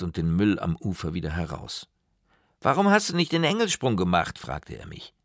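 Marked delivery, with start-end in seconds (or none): 2.6-4.4 put-on high-pitched, insistent boyish question; raised child's voice, pressing the question; let-down boy asking. A narrator's reading. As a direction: demand to know why, like a child. Boy questions friend after the dive.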